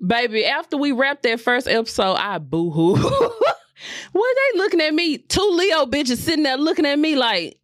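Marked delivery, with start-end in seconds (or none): none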